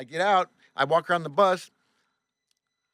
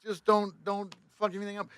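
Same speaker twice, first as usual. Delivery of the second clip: nervous plea; 6 semitones higher than usual. Retold or imitated.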